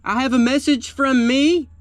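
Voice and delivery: high, loud, ringing proclamation; mock town crier. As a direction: call it out like a herald with news.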